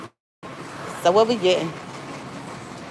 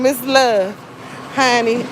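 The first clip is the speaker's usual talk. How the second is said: ordinary talk, nothing marked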